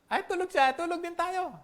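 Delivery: high, easygoing voice of sleepy disciples; lazy disciples. Retold or imitated. Preacher voicing disciples who would rather sleep too. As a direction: shrug it off like a disciple ready to nap. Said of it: "Hindi nila naisip".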